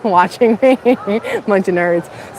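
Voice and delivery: amused jab through a laugh; giggly, affectionate ribbing; laughing tease. Real, not acted. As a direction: laugh while teasing your friends. Teasing friends who watch her.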